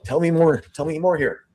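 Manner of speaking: eager investigator. Retold or imitated. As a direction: push her to keep talking. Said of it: "and he's going".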